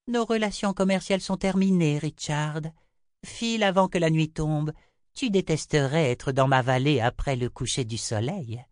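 high, imperious seer voice; Chota, dismissive. A narrator's reading. Chota ends business and sends Richard off, high curt dismissal. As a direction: send him away from a lofty height.

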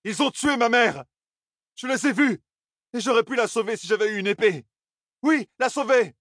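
high-pitched, distraught outburst; anguished cry. A narrator's reading. Grandson tells grandmother about his murdered mother, anguished cry. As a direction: cry it out in grief and protest. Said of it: "cria-t-il".

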